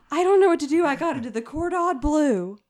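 high, loud mock panic; hammed-up distressed patient voice; mock panicky character. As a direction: whine it out like a frantic mess.

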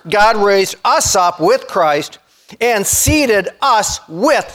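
measured, word-by-word scripture reading; slow, emphatic. Real, not acted. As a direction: draw the words out so each one lands. Preacher repeats verse, stressing words.

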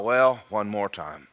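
low, soft, resigned to one last try; discouraged. Acted. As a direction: sigh it out low and dispirited. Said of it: "he's more discouraged than ever".

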